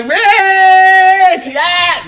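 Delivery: very high, long stretched "Rich, yeah"; drawn-out flirty name.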